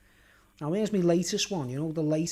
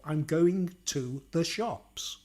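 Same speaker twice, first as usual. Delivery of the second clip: slow, carefully separated posh English; posh accent; much slower than usual. Shown as a demonstration. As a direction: say each word slowly in a posh accent. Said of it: "But the posh accent".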